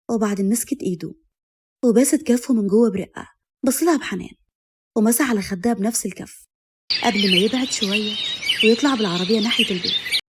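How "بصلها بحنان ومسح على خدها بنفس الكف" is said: ordinary delivery, nothing marked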